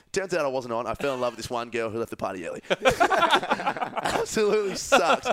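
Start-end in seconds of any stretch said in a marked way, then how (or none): 2.7-5.3 slow, high, laughing groan; mock-heartbroken laugh; laughing lament. Real, not acted. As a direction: laugh through the misery.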